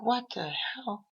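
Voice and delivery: high, slow drawn-out what the hell; disbelieving bystander; stunned disbelief. Retold or imitated. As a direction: let it hang in shock. Speaker in shock.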